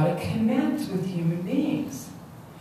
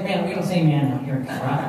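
lower, quick mock correction; imitating a teasing friend; 5 semitones lower, faster than usual. Local teasing a newcomer about his slang, lower mock voice. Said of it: "he teases me about the man".

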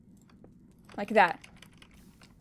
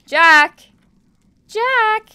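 much higher, loud call across the map; 10 semitones higher, 9 dB louder than usual. Real, not acted. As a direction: shout his name to get his attention. Calling out to opponent.

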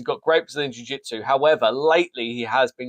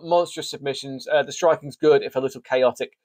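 ordinary delivery

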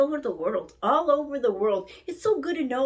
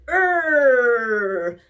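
growling; 5 dB louder than usual. Shown as a demonstration. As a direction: growl instead of rolling r. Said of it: "No, that's growling".

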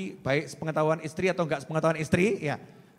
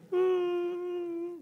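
high, drawn-out mock whimper; long high fake sob; imitated crying; 13 semitones higher, 4 dB quieter than usual. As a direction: imitate a woman's thin wail down the phone. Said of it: "Ibu-ibu nangis".

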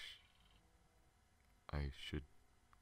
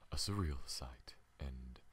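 ordinary talk, nothing marked

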